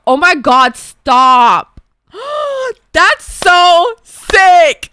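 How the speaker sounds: loud, high, stretched squeal of joy; excited shriek. Real, not acted. Streamer shrieking over friend's red carpet news. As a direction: scream it with delight.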